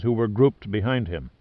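plain reading voice

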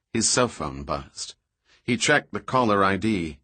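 plain reading voice

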